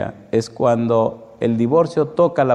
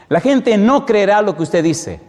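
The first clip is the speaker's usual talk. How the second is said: high, raised preaching voice on "no creerá"; emphatic declaration; 9 semitones higher, 4 dB louder than usual. Real, not acted. Preacher warns the congregation about words alone, high emphatic declaration. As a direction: proclaim it forcefully, pitched up high.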